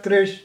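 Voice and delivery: "Krish" cut off after the sh; Gujarati chopped form. Shown as a demonstration. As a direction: stop short on "Krish". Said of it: "in Gujarat".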